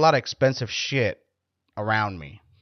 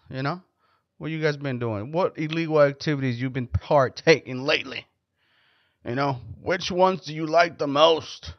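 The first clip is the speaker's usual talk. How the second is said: ordinary talk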